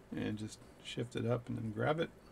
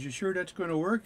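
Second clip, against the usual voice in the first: doubtful Newfie; 7 semitones higher, 4 dB louder than usual. Retold or imitated. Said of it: "He goes".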